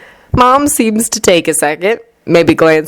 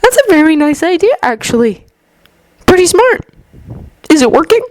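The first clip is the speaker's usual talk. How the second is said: put-on gentle young man voice; 11 semitones higher, faster than usual. A narrator's reading. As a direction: voice Izuku lightly higher, kindly. Izuku voiced by narrator.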